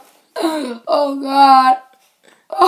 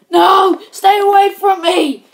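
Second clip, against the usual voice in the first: ordinary talk, nothing marked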